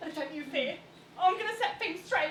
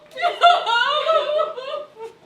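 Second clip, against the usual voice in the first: a big, loud, high burst of giddy laughter; shrieking laugh of pure delight; 5 semitones higher, 10 dB louder than usual. Acted. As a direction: laugh out loud, overcome with joy. Giddy maid overcome with joy.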